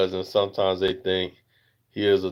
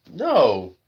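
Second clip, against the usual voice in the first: ordinary delivery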